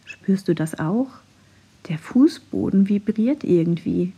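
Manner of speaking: ordinary delivery, nothing marked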